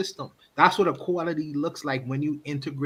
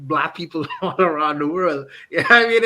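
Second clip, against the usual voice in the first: laughing; 6 dB louder than usual. Real, not acted. Laughs at his own point.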